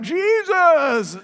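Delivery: high, loud excited call of a name; dramatic cry. Retold or imitated. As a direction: shout Jesus as you leap out of the boat. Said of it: "so dramatic".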